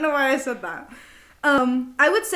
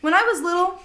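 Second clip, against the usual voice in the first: ordinary talk, nothing marked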